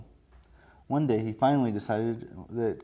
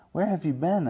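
higher and louder, eager; excited; 6 semitones higher, 4 dB louder than usual. Acted. Fish greeting returning frog friend, excited. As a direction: burst out with joy at the friend's return. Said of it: "asked the fish excitedly".

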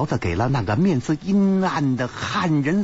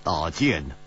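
mock the story with a low sneer; cold sneer; 6 semitones lower than usual. Ji Bingyan doubts the horseman tale, sneering low. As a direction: lower voice, scornful disbelief. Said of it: "冷笑着说".

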